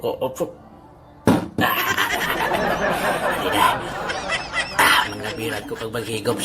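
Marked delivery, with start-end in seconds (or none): 1.8-4.8 eerie ghost laugh. Acted. Ghost laughing at the coffee drinker.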